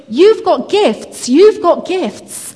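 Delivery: high, slow, drawn-out imitation of a delighted man; happy man's exclamation. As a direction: proclaim it joyfully, stretching each word. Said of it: "he was just so happy".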